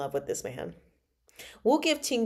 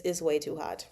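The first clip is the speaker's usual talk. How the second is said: normal talking voice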